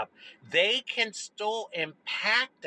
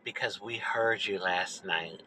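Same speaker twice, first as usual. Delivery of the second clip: hushed almost-whisper; 8 semitones lower, 6 dB quieter than usual. Retold or imitated. Neighbor across the driveway quietly telling the kid they heard her, hushed and low. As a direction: lower and softer, a retold neighbor's hushed concern. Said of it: "he kinda lowers his voice, like he's whispering almost".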